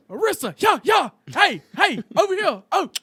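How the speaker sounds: high, loud shout across a store; loud calling out. Retold or imitated. Dad calls daughter across Walmart, loud high shout. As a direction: holler for her across the store. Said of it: "loud as fuck inside Walmart, is like".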